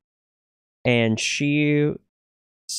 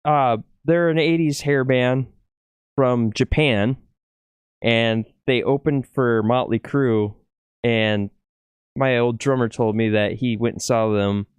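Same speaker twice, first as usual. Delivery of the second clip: normal talking voice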